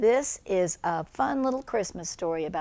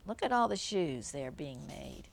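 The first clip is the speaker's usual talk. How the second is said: ordinary delivery, nothing marked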